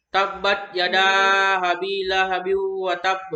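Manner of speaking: slow, drawn-out recitation with firm echo on "tab"; strong bounced ending. Shown as a demonstration. Teacher showing qalqalah kubra, strong bounce ending "tab". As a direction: recite "tabbat yada abi lahabi wa tab" slowly, bouncing the final b. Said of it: "cukup kuat".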